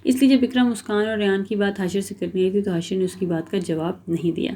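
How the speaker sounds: plain reading voice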